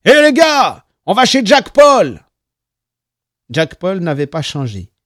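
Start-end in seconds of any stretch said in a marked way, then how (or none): 0.0-2.2 high, loud rallying shout. A narrator's reading. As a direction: yell it out to the whole gang. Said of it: "quelqu'un finit par crier".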